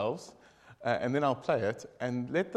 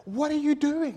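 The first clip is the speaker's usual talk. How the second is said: high, loud shout of an angry farmer; angry owner; 13 semitones higher, 7 dB louder than usual. Acted. Owner shouts at man driving his tractor, high angry yell. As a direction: yell at the intruder.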